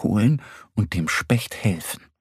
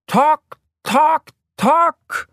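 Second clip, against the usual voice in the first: high, loud, separated calls; ringing high shout; shouting as loud as possible; 5 dB louder, much slower than usual. A narrator's reading. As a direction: belt out each knock like a call across the forest. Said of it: "rufen so laut sie können".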